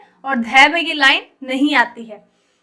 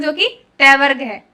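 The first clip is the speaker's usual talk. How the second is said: ordinary delivery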